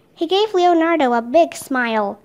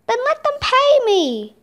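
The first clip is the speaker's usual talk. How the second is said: high, indignant bird seller voice; indignant demand; 8 semitones higher than usual. Acted. Bird seller wants payment for bird, high indignant demand. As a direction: demand it, pitched well up.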